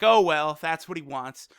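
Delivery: normal talking voice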